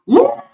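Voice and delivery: very high, loud yelp of pain. Retold or imitated. Retelling kidney stone pain, a sudden high yelp. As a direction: cry out like it's tearing you. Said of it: "bite, like, a hand towel and be like".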